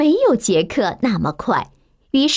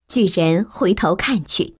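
ordinary delivery

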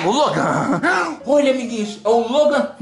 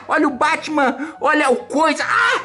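usual speaking voice

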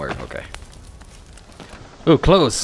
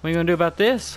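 ordinary delivery

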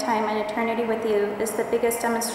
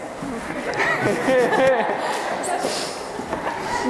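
a breathy giggle; laughing; 3 semitones higher than usual. Real, not acted. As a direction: let a light laugh out. Bride laughs before her vows.

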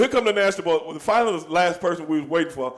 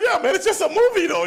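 high, louder imitation of a nervous kid; sheepish young guy; 6 dB louder than usual. Matt Damon shrugging off the wrestlers, high mock voice. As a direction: play him brushing it off, high and laughing.

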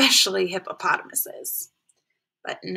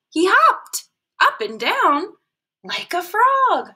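higher, louder, slow bouncy reading; bouncy excited; 9 semitones higher, 5 dB louder, slower than usual. A narrator's reading. Storyteller showing frog hopping, bouncy excited.

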